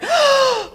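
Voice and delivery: high gasp as she breaks the surface; sharp gasp for air. A narrator's reading. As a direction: suck in air desperately. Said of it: "took a big gulp of air".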